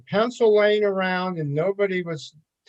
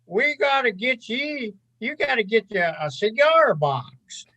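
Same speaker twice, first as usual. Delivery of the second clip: put-on rural Kansas accent; 5 semitones higher than usual. Lead forecaster welcomes newcomer on first shift, rural Kansas accent. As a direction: put on an old colleague's rural Kansas twang. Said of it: "his, his rural Kansas accent".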